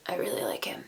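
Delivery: whispered confession. Real, not acted. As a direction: let it slip in a whisper. Secret crush aside.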